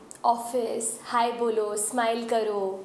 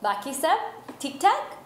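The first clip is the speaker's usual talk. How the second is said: higher, lilting questions from the companion; chatty check-in, swinging up at each end; companion's small talk; 3 semitones higher than usual. Acted. Companion asks the heroine how she is, lilting upward. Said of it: "साथी कहता है".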